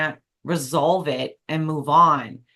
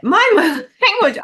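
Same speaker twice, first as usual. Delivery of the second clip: laughing apology, much higher and louder; 13 semitones higher, 9 dB louder, slower than usual. Real, not acted. Host laughing at her own swear word, real laughter.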